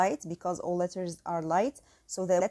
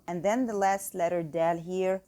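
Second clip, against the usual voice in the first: ordinary delivery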